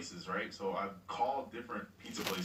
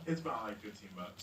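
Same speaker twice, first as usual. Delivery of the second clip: ordinary delivery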